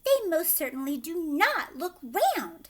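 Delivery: loud, high, indignant denial; flat refusal, chin up; Veruca indignant. A narrator's reading. Veruca snapping back at Wonka, loud and indignant.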